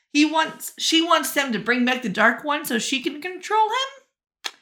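ordinary talk, nothing marked

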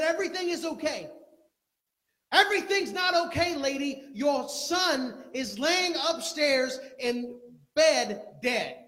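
loud disbelieving reply to a mother in denial; servant's loud rebuke. Acted. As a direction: shout the hard truth at her, dragging it out. Retold servant's angry retort.

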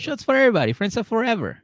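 high, loud imitation of a party cheer; acted-out boozy enthusiasm; rallying the party. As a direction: call out a round to the room. Acting out friend rallying everybody.

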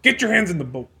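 play-acted exasperated shout; scolding ferryman. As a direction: yell at careless tourists on your boat.

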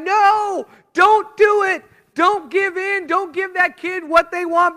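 high, loud mental yell of "don't do it"; inner shout. Retold or imitated. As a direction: plead at the parent in your head, nearly shouting. Inner voice at a parent giving in.

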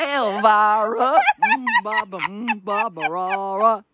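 slow vampy seductress voice; Elvira voice. Pretend. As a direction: purr like a campy vampire queen. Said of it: "I think of, like, Elvira".

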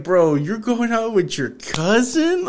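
high, slow incredulous question; mock disbelief. Telling a friend, retelling shock at her post, high slow disbelief. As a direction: drawl it out, not believing it.

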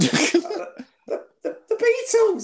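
high, loud exclamation; excited shout. Real, not acted. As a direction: burst out with the answer. Blurting the obvious answer excitedly.

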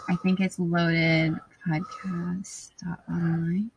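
slow, uncertain whispered guess; unsure whisper. Real, not acted. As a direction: whisper it, not sure at all. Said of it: "I wasn't even close".

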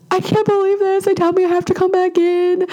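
high-pitched frantic panic, overdoing it; panicked high wail. Retold or imitated. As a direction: wail it like you're losing your mind over a bleeding mole. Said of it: "Like, I'm literally going crazy".

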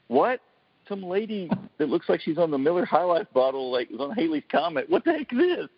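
high, incredulous mock rant; mock disbelief. Host retelling watching a tame show, high-pitched mock disbelief. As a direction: scoff at the show in an exaggerated high voice.

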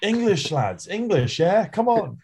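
high-pitched mock coach geeing up the lads; mock French coach urging. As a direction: ham up a coach egging the team on, pitched high. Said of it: "No, he'd be like".

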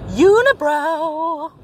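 a slow, high teasing call; drawn-out high quip. Real, not acted. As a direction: stretch the word up high, teasing. Remark to passing stranger.

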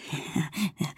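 quiet self-satisfied laugh; gloating chuckle. A narrator's reading. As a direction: chuckle smugly to yourself. Maciuś gloats to himself.

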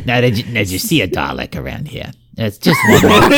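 a hint of a rumpled TV detective; casually probing detective impression, lightly voiced; Columbo. Cohost playfully questioning like the TV detective, mock Columbo voice. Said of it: "getting a real Columbo energy".

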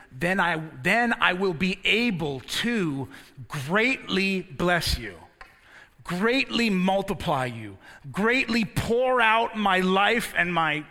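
rising from firm to shouting sermon; building to full-force preaching. Acted. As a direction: preach it harder and harder until you are shouting.

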